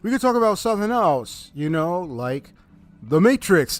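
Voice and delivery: loud, hammed-up persistent caller; trying to sound serious. Caller steering back to The Matrix, loud and mock-serious. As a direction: build to the title with mock gravity. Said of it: "trying to sound all serious".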